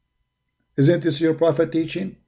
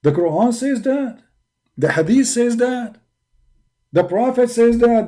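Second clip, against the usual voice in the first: higher, louder, hammering each proof home; emphatic proof list; 6 semitones higher, 3 dB louder than usual. Real, not acted.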